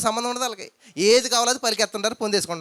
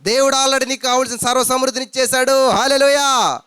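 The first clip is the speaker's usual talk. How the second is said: raised fervent preaching; preaching at full force; 4 semitones higher, 5 dB louder than usual. Acted. Preacher rousing the congregation, fervent and raised. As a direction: drive the point home to the church.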